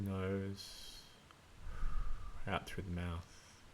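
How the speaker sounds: soft, unhurried meditation voice with long pauses; hushed, spacious delivery, leaving room to breathe; guided meditation. Acted. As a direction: gently guide listeners into their breathing.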